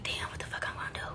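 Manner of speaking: a scared whisper to herself; panicked whisper. Retold or imitated. Broke at the doctor, whispering in panic to herself. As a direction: whisper it like you just saw the bill.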